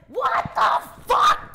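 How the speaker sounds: high, loud, slow shriek of disgust; shocked shout. Retold or imitated. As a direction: scream it like you just got peed on.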